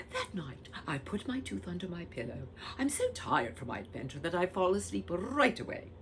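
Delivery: usual speaking voice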